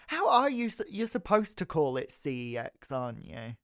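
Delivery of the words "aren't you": ordinary talk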